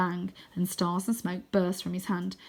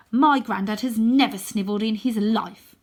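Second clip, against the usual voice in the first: loud, firm defiance; defiant retort; 3 semitones higher, 8 dB louder than usual. Acted. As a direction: stand up to the monster. Arthur standing up, defiant.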